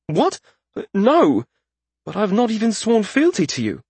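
high, startled outburst. Acted. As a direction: blurt it out in shock. Startled disbelief.

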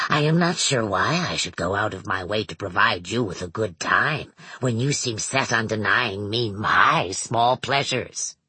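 deep, measured villain voice; Manx's low voice. A narrator's reading. Narrator voices Manx.

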